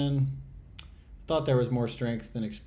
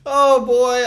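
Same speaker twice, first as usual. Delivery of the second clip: excited yelp; 13 semitones higher, 17 dB louder than usual. Real, not acted. Trader yelps as his all-in bet pays off, excited.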